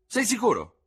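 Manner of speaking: high, loud question; calling down. A narrator's reading. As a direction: shout down to check. Surgeon calls down from window.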